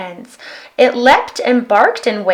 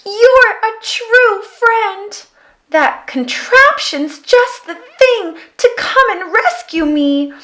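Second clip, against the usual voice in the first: grateful aardvark; 12 semitones higher, 3 dB louder than usual. A narrator's reading. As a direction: thank them with a wobble. Aardvark voice continues.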